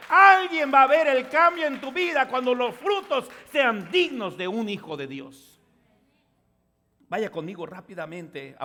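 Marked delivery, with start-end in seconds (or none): 0.1-5.6 loud, high pulpit preaching; fiery sermon delivery; preaching at full force. Acted. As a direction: drive the point home to the congregation.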